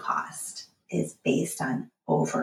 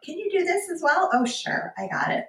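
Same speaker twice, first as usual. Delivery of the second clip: mock eager helper; 12 semitones higher than usual. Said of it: "one of the things I always say".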